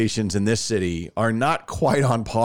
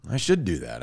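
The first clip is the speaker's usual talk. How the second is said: usual speaking voice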